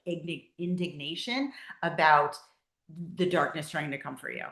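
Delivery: usual speaking voice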